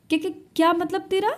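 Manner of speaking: high, fast, stammered shock; stunned stammer. Acted. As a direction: caught off guard, stumbling on the words. Stunned by brother's accusation.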